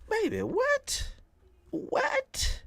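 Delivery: very high, slow disbelieving squeak; incredulous shock. Real, not acted. Streamer hearing a huge hardware boast, high shocked squeak.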